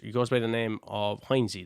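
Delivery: usual speaking voice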